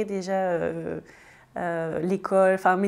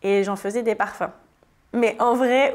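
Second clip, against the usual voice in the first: normal talking voice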